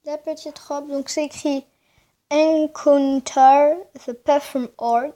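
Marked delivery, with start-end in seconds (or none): 2.3-5.1 loud, slow English with a French accent; carefully spaced English words, strong and French-accented. Real, not acted. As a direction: read it out loudly and slowly in imperfect, French-accented English. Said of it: "j'ai pas un très bel accent anglais".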